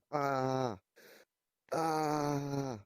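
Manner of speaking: high strained mock scream of the chaser; imitated screaming. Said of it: "He was just going".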